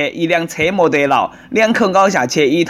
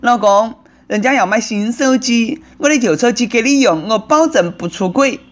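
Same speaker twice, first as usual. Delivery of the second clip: higher-pitched put-on wife voice; wheedling wife; 6 semitones higher than usual. Wife asking husband for a new phone, wheedling. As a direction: coax like a pleading wife.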